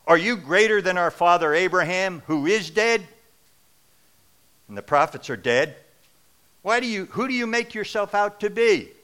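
loud, high accusing voice. Acted. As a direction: hurl the accusation at him. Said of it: "they're raising their voice".

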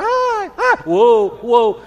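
high Ahs and loud Whoas tumbling together, ending in a laugh; mock racket. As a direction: recreate the whole noisy party, laughing. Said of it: "And so you got".

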